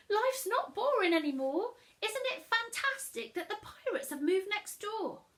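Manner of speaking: put-on high, loud gushing girl; blurted excitedly. Matilda telling her parents, blurting it out excitedly. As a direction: burst out with delight. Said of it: "Tilda blurted".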